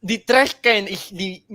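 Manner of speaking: loud, high Slavic-accented philosopher impression; heavy Slavic accent, emphatic philosophical rant; Slavoj Žižek. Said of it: "my impression of Slavoj Žižek".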